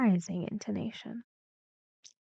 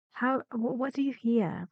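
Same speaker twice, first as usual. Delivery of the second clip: ordinary talk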